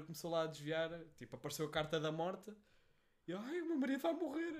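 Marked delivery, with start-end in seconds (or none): 3.4-4.5 high wailing "Ai, o meu marido vai morrer"; shrieking housewife caller, mock panic; hammed-up desperate woman phoning a TV psychic; panicking caller. Caller panicking on tarot show.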